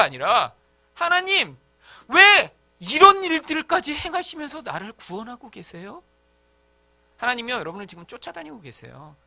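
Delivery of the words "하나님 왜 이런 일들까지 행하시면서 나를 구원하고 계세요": high, loud and slow amazed prayer; wondering cry. Retold or imitated. Pastor voicing the prayer of a changed heart, loud amazed cry. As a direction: marvel aloud at God.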